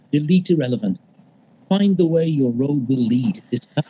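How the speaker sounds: usual speaking voice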